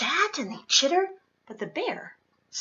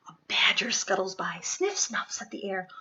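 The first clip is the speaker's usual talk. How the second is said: quick, hushed storytelling; scurry through it in a whisper; hushed quick narration; 4 semitones lower, 4 dB quieter, faster than usual. A narrator's reading. Reading the badger's sneaky entrance aloud, fast and hushed.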